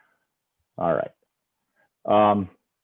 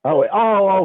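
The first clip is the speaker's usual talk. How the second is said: surprised; 12 semitones higher, 6 dB louder than usual. Real, not acted. Teacher realises answer was in chat.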